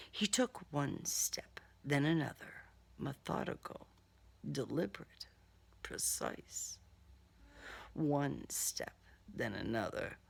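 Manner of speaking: soft, low, hushed narration; tense hush. A narrator's reading. Narrator hiding as the killer creeps closer, hushed. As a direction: breathe each step out like a secret.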